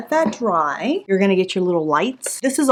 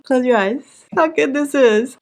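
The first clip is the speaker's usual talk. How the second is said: dreamy gushing; 6 semitones higher than usual. Real, not acted. Smelling perfumes, gushing.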